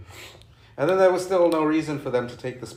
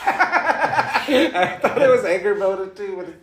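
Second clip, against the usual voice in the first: laughing while talking, louder; 11 semitones higher, 8 dB louder, slower than usual. Real, not acted. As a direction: talk through the laughter. Laughing over actress mix-up.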